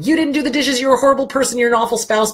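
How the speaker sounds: hammed-up accusing spouse; mock accusing rant. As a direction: fire off the blame like shots. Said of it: "whipping out the you, you, you guns".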